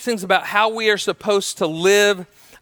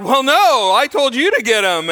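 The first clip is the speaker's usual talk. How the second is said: higher and louder, defensive snap; mock annoyed retort; 5 semitones higher, 4 dB louder than usual. Wife Brenda snapping back at her husband about the kids, mock annoyed and loud. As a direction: toss the blame right back at him. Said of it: "Brenda's like".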